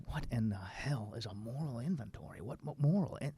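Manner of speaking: soft, puzzled mock muttering; a touch of lost confusion under the breath; hushed bafflement. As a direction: quietly relive not getting it. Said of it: "I still scratched my head and said".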